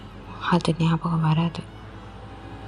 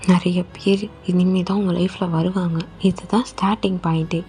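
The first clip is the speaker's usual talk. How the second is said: normal talking voice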